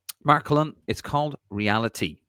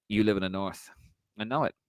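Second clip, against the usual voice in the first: ordinary talk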